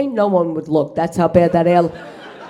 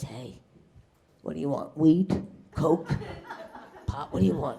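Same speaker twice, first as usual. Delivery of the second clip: low hushed dealer voice, much softer; island dealer; 5 semitones lower, 8 dB quieter than usual. Retold or imitated. Beach dealer offering drugs, low and hushed. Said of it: "he goes".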